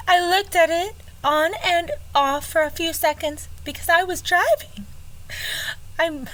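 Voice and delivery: high, hammed-up Georgia belle; swooning Southern belle, pitched high. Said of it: "should I do it again? The Georgia?".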